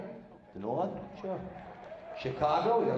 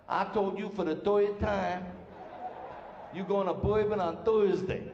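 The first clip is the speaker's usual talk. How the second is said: slow New Orleans drawl crossed with Brooklyn; half Southern, half Brooklynese; slower than usual. Shown as a demonstration. New Orleans local talking, slow Southern-Brooklynese accent. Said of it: "They talk half Southern, half Brooklynese".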